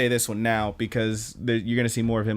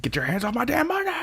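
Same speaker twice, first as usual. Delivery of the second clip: screeched much higher, fast and furious; raging scream; 13 semitones higher, faster than usual. Retold or imitated. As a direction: shriek it like she's losing it over the money. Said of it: "she rages out".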